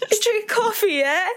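put-on high laughing British accent; British yeah, laughing through it. To Danny over coffee again, high laughing British accent. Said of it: "we're yelling it too".

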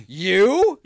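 loud, high incredulous You; mock dismissive. Owner scoffing at the customer, mock incredulous.

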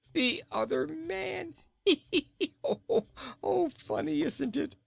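high, gleeful giggly voice; laughing. A narrator's reading. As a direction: squeal with delight at the joke. King laughs at own joke.

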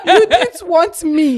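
slow, laughing words. Real, not acted. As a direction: laugh it out slowly. Speaking through laughter.

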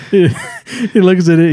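ordinary talk

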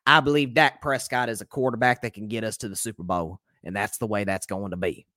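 a hint of Jerry Jones, low and steady; Jerry Jones impression. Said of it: "That's my best Jerry".

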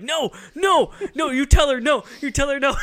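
high, loud imitation of a panicked husband; mock frantic protest. Dan to Roseanne on the phone, mock frantic protest. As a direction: object urgently, hammed-up.

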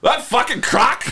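high, slow hammed-up Aussie; Australian accent. Shown as a demonstration. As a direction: draw it out like an excitable Australian. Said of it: "Let's hear your Australian accent".

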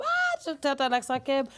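high, loud cry of discovery; mock exclamation. People in Senegal spotting her Québec accent, mock loud exclamation. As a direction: leap up high and loud, imitating amazed reaction. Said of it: "je vais au Sénégal, je suis comme".

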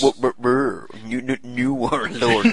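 teasing stutter on repeated opening sounds; mock stammer. Co-host teasing the host's stumble over "were", mock stammer. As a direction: imitate someone tripping over the words.

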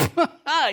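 sudden high burst of laughter; disbelieving laugh. Retold or imitated. Old classmate laughing on hearing he became a pastor, high and loud. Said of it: "He's like".

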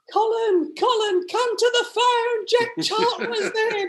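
very high, loud falsetto mum calling her husband; hammed-up piercing falsetto call; Dame Edna. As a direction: shriek it across the house. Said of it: "I know I sound like Dame Edna".

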